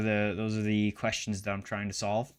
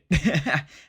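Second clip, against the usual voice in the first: loud burst of laughter at his own joke; 7 semitones higher, 10 dB louder than usual. Real, not acted. Vlogger laughing after jokingly blaming Trump, loud laugh.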